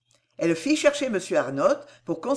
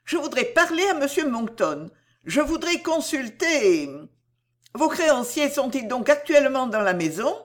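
high, tentative heroine; hesitant, higher-pitched reply; 6 semitones higher than usual. A narrator's reading. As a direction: feel your way into the request. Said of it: "répondit Cécile en hésitant".

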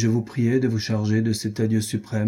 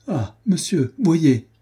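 high, loud terrified plea; terrified cry; 7 semitones higher, 4 dB louder, much slower than usual. A narrator's reading. Adrienne in terror handing over the letter, high panicked cry. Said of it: "folle de terreur".

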